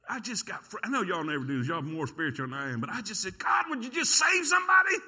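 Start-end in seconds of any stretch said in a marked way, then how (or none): 3.4-5.0 high, loud cry to God; exasperated plea. Retold or imitated. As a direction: shout it upward in frustration. Discouraged preacher retells his outburst prayer.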